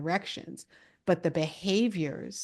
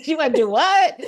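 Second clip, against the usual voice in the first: high, loud laughing disbelief; laughing while talking; 10 semitones higher, 11 dB louder than usual. Retold or imitated. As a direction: laugh out a mock-shocked question. Mocks father's disbelief, laughing.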